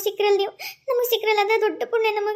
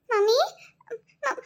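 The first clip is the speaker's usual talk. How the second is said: tearful; 5 semitones higher, 4 dB quieter, slower than usual. Acted. Daughter calls her unconscious mother.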